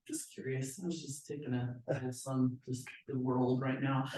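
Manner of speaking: very soft, low, halting retreat; meek backpedal. Retold or imitated. Backing off after the lender balks at cost, meek. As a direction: imitate backing off in a small, quiet voice.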